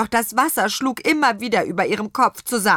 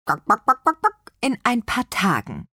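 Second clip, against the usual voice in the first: higher clucks to the hens; clucking reply; 5 semitones higher than usual. A narrator's reading. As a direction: answer the hens in their own language. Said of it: "antwortete Petronella".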